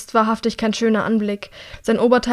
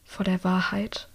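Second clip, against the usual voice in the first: slow, much softer, downcast; sad quiet answer; 3 semitones lower, 8 dB quieter, slower than usual. A narrator's reading. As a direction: let it fall heavy and soft. Sad answer after crying.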